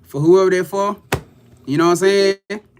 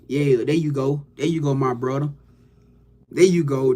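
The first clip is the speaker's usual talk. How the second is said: ordinary talk, nothing marked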